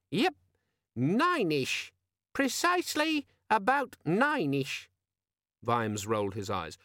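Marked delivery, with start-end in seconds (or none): 0.1-1.9 high, perky little gadget voice; squeaky friendly demon. A narrator's reading. Pocket demon confirming the time to Vimes, squeaky and friendly. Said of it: "had the squeaky, friendly quality". 2.3-4.9 very high, slow, drawn-out squeak; squeaky friendly demon. A narrator's reading. Pocket demon insisting on the time, squeaky and slow. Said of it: "had the squeaky, friendly quality".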